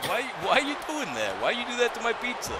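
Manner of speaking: mock Italian New Yorker. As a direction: play a random Italian guy from New York, a joke version. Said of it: "sounds like some random Italian guy from New York".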